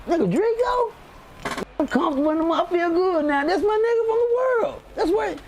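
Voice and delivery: very high-pitched, delighted outburst; retold excitement. Greeting an old friend found inside prison, excited. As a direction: relive the thrill, voice shooting way up.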